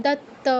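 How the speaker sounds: দত্ত with a held double t; doubled consonant. Shown as a demonstration. Teacher demonstrates double letters, দত্ত with doubled t. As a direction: hold the tt in দত্ত. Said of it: "double থাকলে double উচ্চারণ করতে হবে".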